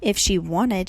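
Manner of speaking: plain reading voice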